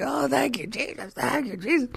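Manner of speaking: high, strained, cracking praise; acted voice-gone rejoicing; froggy hoarseness. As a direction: squeeze out thanks with a worn-out voice. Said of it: "I felt like a frog".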